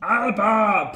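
loud high battle cry; shouted Alba. Retold or imitated. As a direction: shout it like a warlord. Imitating the played Alba shout.

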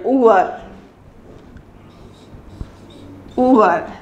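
Uhr said like oor; long rounded oo in Uhr; teacher models the German word clock. Shown as a demonstration. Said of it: "in German it's U".

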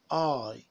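"I" drawn out, gliding from open to close; long I diphthong. Shown as a demonstration. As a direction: model "I" slowly for repeating, tune falling. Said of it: "this is pronounced I".

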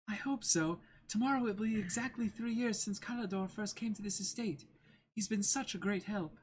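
put-on high, polite girl's voice; young lady Kaya. Kaya thanking her butler, high and polite. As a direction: thank the servant sweetly. Kaya's reply in voice.

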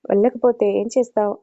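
ordinary delivery, nothing marked

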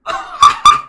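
high squealing laugh; shrieking laugh. Real, not acted. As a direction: let out a delighted shriek.

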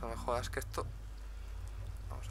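ordinary talk, nothing marked

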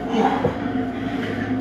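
breathless whew; gasping for air. Real, not acted. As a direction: blow out a tired breath. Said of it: "Sorry, I'm out of breath".

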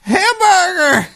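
big, high, drawn-out yell; mock announcer yell; hamburger shout. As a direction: bellow the word at the top of the lungs. Echoing a hamburger soundboard clip.

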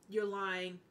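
usual speaking voice

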